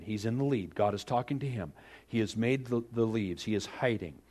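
ordinary talk

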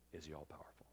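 hushed rhetorical whisper. Acted. Preacher whispers to the congregation about God's power. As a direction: lean in and breathe the question.

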